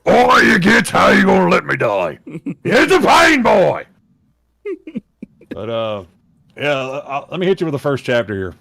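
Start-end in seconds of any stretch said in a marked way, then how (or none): 0.1-3.9 high, loud dying orc. Acted. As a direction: bellow last words as an orc. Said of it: "doing the orc voice for the last time".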